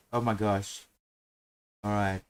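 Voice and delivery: ordinary talk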